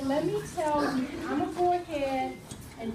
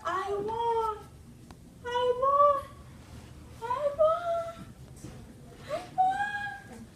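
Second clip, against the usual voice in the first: rising higher and higher eerie wail; the basement ghost wailing, climbing in pitch; ghostly echo; 11 semitones higher, much slower than usual. Acted. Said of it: "the echo coming from the basement".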